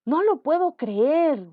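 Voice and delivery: alto y fuerte, lento, incrédulo; asombro. Shown as a demonstration. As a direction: exclamar con asombro sin creerlo. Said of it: "De asombro". (no lo puedo creer, asombrada y fuerte)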